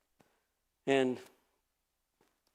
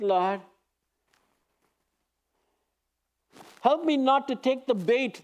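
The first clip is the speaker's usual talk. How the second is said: lightly voiced prayer, a touch raised and pleading; earnest prayer; 6 semitones higher than usual. Preacher voicing a congregant's prayer, in lightly earnest prayer tone. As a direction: pray it humbly, as if to God.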